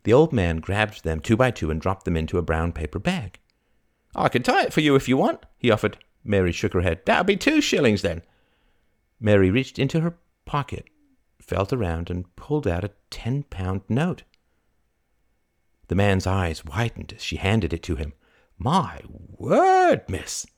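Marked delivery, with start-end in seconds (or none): 4.1-5.4 quick, high-pitched offer; old fruit vendor, obliging. Acted. 7.1-8.2 higher-pitched market seller; name the price in the trader's voice; old fruit vendor, businesslike. Acted. 18.6-20.5 high, drawn-out amazement, then dropping; astonished exclamation. Acted. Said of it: "he exclaimed".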